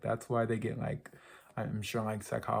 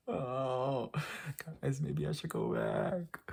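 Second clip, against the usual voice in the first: dismayed whine; 3 semitones higher, much slower than usual. Real, not acted. Pleading with viewers after nervous laugh.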